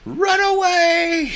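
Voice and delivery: high, slow, shrieking retreat cry; panicked cry. Retold or imitated. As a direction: wail it like a fleeing coward. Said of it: "Festus in the lead of".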